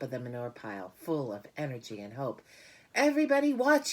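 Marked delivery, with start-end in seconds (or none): 2.9-3.9 high, loud, quick call; cried out. Acted. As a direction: shout for everyone to look. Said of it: "he cried".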